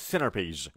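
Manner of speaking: centerpiece low, as "senner-piece"; American dropped T. Retold or imitated. Teacher imitating YouTubers' American pronunciation, dropped T. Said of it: "انگار میگه سنر".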